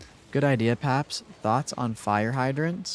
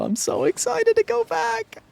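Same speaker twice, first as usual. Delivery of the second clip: excited; 3 dB louder than usual. Real, not acted. Gushing about horror marathon.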